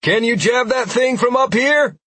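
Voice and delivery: loud, high yell over the wind. A narrator's reading. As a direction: shout it back from the saddle. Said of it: "I yelled back".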